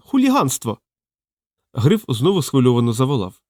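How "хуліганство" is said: very high indignant squeal. A narrator's reading. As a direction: shriek it in pitch, without much added volume. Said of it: "вищав він".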